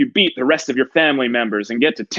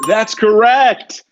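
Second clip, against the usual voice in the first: booming announcer; 6 semitones higher, 5 dB louder, much slower than usual. Acted. Game show host confirms answer.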